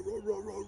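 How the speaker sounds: a high, rhythmic mock roar; imitated lemur roar. Keeper imitating the lemur's daytime call for the crowd, mock roar. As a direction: roar it out like a lemur calling through the forest. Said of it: "it sounds like".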